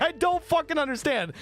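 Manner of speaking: high, exasperated retold protest; mock frustration. Confused viewer gives up on microwave explanation, mock frustration. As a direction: raise the pitch as the viewer who is lost. Acted viewer losing patience.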